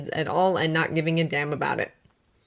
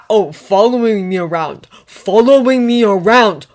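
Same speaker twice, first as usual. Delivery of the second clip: mocking; 3 semitones higher, 12 dB louder than usual. Mocking repetition in quarrel.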